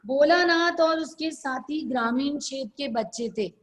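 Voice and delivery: quick run-through of the sentence; whole sentence in one quick breath; brisk dictation. Real, not acted. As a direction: read it straight through at a fast clip. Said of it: "इसको जल्दी जल्दी बोलते हैं".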